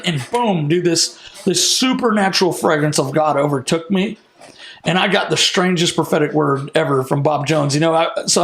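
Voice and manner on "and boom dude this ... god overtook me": ordinary delivery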